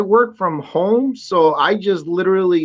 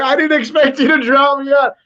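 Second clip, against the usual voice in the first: ordinary delivery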